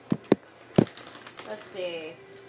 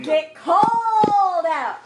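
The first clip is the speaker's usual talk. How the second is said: excited taunt; 7 semitones higher, 6 dB louder, much slower than usual. Real, not acted. Taunting cheer at the table.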